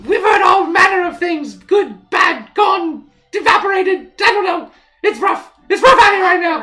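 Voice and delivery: very high, loud squeaky chatter; squeaky halfling. Acted. Fleeing halfling answers travellers' questions, squeaky and loud. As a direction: talk loudly in a tiny, squealing voice. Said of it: "this kinda squeaky voice like".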